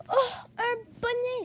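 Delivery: high, drawn-out cry of delight; thrilled rabbit opening a present; delighted exclamation. Acted. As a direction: exclaim slowly, pitched right up with joy. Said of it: "s'écrie-t-il".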